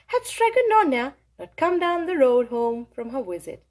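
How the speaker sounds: ordinary delivery, nothing marked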